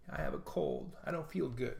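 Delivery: a hint of a whiny cold-stricken voice; mock sick whine. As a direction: play the pitiful sick husband. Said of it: "That's his response".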